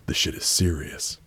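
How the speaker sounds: ordinary talk, nothing marked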